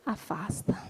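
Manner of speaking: hushed breathy whisper on the word. Acted. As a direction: drop to a whisper as the child pulls away. Child pulling away, whispered.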